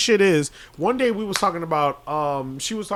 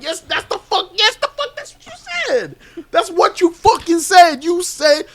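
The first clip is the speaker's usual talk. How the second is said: high, loud incredulous yell at coworker; heated yelling; 11 semitones higher, 6 dB louder than usual. Retold or imitated. To the coworker who denied it, a high loud exasperated yell. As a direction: shout it in disbelief, voice cracking up high.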